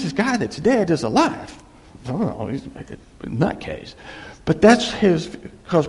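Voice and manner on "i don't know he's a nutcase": under the breath, he's a nutcase; muttered dismissal. Retold or imitated. Official whispering his scorn of Paul's claim.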